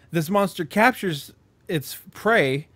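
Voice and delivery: ordinary talk, nothing marked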